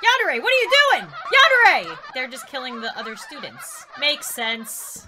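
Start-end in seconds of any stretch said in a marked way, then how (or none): 0.0-2.0 high, loud, fast shout; shocked scolding. Real, not acted. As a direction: yell at someone caught in the act. Scolding a character caught killing.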